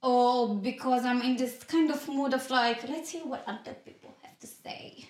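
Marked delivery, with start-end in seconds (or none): 2.9-5.1 whispered. Retold or imitated. Quoting own mood.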